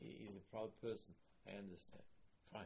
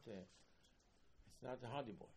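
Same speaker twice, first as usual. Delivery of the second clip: normal talking voice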